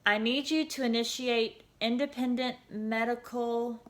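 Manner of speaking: shaky urgent request; nervous. A narrator's reading. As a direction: ask for help through rising panic. Frightened girl stumbling over words.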